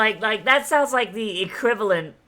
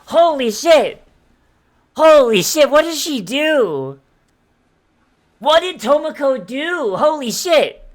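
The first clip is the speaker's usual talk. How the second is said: loud, high, astonished shouting; shocked outburst; 8 semitones higher, 10 dB louder than usual. Real, not acted. Streamer reacting to a game character's prison secret, shocked shouting. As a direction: blurt it out in disbelief, loud and high.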